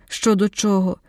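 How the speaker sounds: plain reading voice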